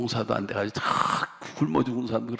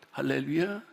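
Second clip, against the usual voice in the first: ordinary talk, nothing marked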